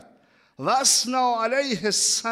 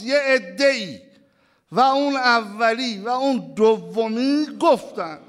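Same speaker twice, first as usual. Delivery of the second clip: full-force preaching; 3 semitones higher, 6 dB louder than usual. Acted. Preacher telling the mourners the hadith, loud and fervent. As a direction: proclaim the hadith to the congregation.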